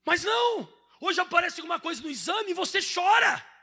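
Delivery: a high, loud shout of protest; exclaiming rebuke. Real, not acted. Preacher scolding a frightened church, loud high outcry. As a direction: cry out against their fear.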